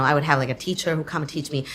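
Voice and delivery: ordinary delivery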